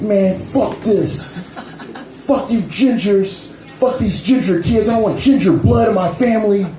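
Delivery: imitating angry dad. Girlfriend's father cursing the gingers, mock angry. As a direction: ham up the father's fury. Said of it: "He was like".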